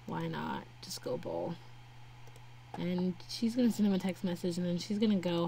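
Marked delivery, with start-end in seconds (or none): 0.1-1.5 whisper. Real, not acted. Muttered aside to Sims character.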